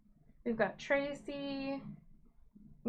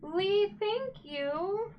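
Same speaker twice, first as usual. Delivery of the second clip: excited thanks; 10 semitones higher, 4 dB louder, much slower than usual. Real, not acted. Thanking first-time donor.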